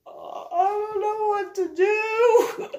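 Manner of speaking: high, slow lost voice; flustered panic. Retold or imitated. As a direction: sound helpless and panicky. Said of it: "And it's like".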